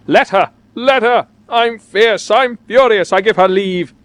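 loud, high, bursting exclamations; raging outburst in short bursts; furious cry. A narrator's reading. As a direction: explode in theatrical fury.

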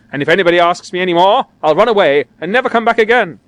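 emphatic, petulant vow; defiant refusal. A narrator's reading. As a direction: burst out with stubborn resolve. Young man refuses and threatens flight.

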